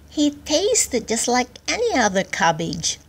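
a hint of a small, modest creature; humble little worm. A narrator's reading. Little worm after its meal of the king, humble. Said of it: "said the humble little worm".

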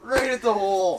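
high, loud cry of frustration; excited shout. Real, not acted. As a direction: yell it at the missed fish. Fish lost at the hole.

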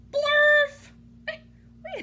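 high, loud shout of nonsense; loud silly shout. Acted. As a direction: belt it out like a cartoon.

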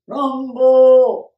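loud, drawn-out rumble word; elephant rumble. Acted. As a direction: boom it like a big rumbling sound. Elephant rumble sound in story.

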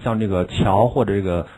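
usual speaking voice

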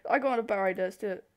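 ordinary talk

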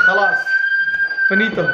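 mock-final, squeaky "Khalas. Finito."; dramatic high declaration. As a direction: announce that you're finished with a flourish. Declaring he's done.

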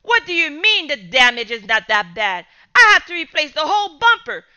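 loud, fast indignant rant; angry father. Acted. Father raging about the bumper cost, loud anger. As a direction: tear into him.

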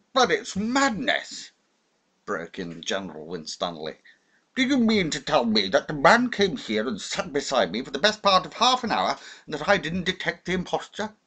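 put-on high, loud blustering old officer; protest, outraged and disbelieving; the general protesting he was fooled; indignant general. A narrator's reading.

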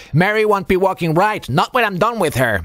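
loud, high-pitched cocky boast; boastful. Acted. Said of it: "Matrose Schmidt boasted".

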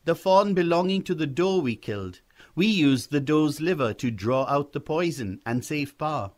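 put-on a boy earnestly making his case; explaining young boy.

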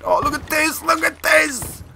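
very high-pitched outcry; exasperated cry. Real, not acted. Player watching controller fail, high exasperated cry. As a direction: cry out in helpless disbelief.

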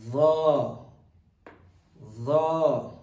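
THE long and loud, tongue out. Shown as a demonstration. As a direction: bring the tongue out for a full-mouth THE. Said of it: "You need to bring out your tongue".

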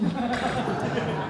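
a short, bright burst of laughter pitched high; amused laugh. Real, not acted. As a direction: laugh at your own punchline. Laughs at his own joke.

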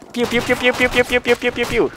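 high, playful pew pew sound effects; pew pew gun noises. Pretend. Imitating gunfire with pistol.

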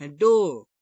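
डो with the final r dropped; डो American style, no r; silent r. Shown as a demonstration. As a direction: say डो ending on a long o. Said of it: "American लोग आर बोलते ही नहीं".

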